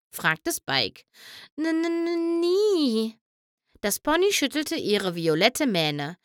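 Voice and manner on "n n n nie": high, hushed stutter; timid stammer. A narrator's reading. The lonely pony admits to Spike she never had friends from outside. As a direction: stammer the answer shyly.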